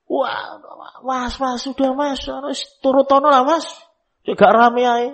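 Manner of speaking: high, pleading wife begging her husband. Retold or imitated. Wife begging husband to give the crying child what he wants, high and pleading. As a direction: whine at him to just give in.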